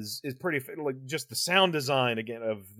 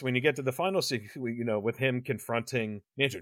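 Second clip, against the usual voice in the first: ordinary delivery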